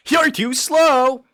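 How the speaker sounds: high, loud, drawn-out cartoon taunt; Sonic. Streamer testing if he sounds like Sonic, taunting loudly. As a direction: copy the game clip at full volume. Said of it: "That doesn't sound like me".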